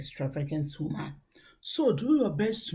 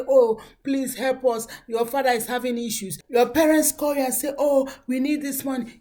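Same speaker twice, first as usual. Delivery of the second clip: mock pleading; 5 semitones higher, 5 dB louder than usual. Parents pleading for money.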